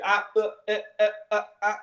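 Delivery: choppy glitching stutter; skipping cd. Retold or imitated. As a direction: repeat uh like a skipping CD. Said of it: "sounds like a skipping C D".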